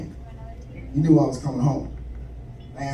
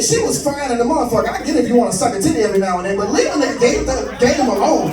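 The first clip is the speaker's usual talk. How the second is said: ordinary talk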